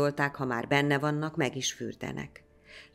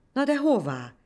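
much higher, louder worried question; anxious villager; 10 semitones higher, 6 dB louder than usual. A narrator's reading. A villager asking the priest anxiously, higher and louder. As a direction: ask in alarm.

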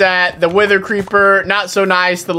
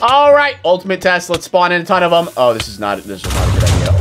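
usual speaking voice

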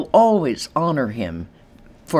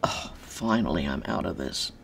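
inner relief; 7 semitones lower, 8 dB quieter than usual. Retold or imitated. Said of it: "other side is going".

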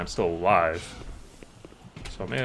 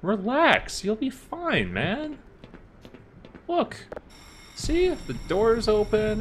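much higher, sing-song encouragement to a wounded buddy; high coaxing voice; 9 semitones higher than usual. Pretend. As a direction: coax him along like a child.

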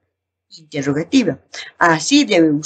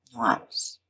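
hushed punchline; 9 semitones lower, 12 dB quieter than usual. Shown as a demonstration. Punchline after ellipsis pause.

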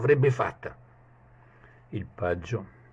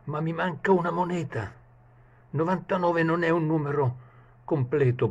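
a much higher, strained voice for the servant; fretting page; 9 semitones higher than usual. Acted. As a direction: worry aloud like a greedy servant. Page's thoughts continued.

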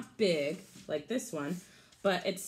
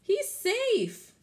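high, loud relieved call; joyful call; 7 dB louder than usual. Acted. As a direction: shout the good news to the others. Calling out found dog.

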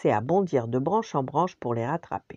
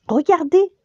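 much higher, louder delighted squeal; excited cry; 10 dB louder than usual. Acted. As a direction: squeal at the feast like a hungry child. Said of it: "s'écria Colline".

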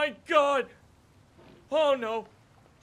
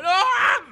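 loud, high scream; frustrated scream; 12 semitones higher, 12 dB louder than usual. Real, not acted. Screams at falling.

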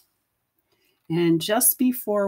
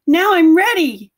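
excited little fox; 10 semitones higher, 9 dB louder than usual. Acted. Little fox proudly ready.

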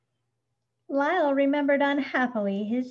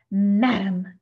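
loud, outraged gasp; enraged gasp; 3 semitones lower, 7 dB louder than usual. A narrator's reading. Mister Grumps purple with rage to Missus Prim, gasped. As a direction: choke it out in rage. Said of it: "he gasped".

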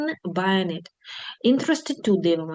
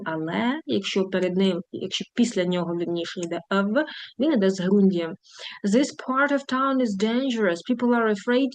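usual speaking voice

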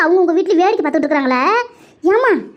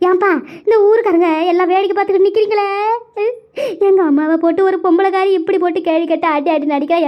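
higher, sobbing village wail; tearful lament; 3 semitones higher than usual. Acted. Daughter crying to the watching villagers over her mother, wailing. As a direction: wail each line with rising ends and sobbing breaths.

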